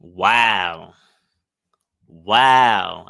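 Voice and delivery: loud, drawn-out wow of amazement; stunned wow. Real, not acted. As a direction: react like the story just floored you. Reacting to the played story.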